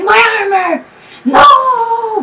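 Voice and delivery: high, loud, hammed-up wail; drawn-out pretend cry of despair; mock anguished cry. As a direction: play at crying out over a terrible loss. Acts out losing armor to fire.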